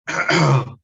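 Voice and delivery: a sharp clearing of the throat. Real, not acted. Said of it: "I'm sorry if I keep coughing".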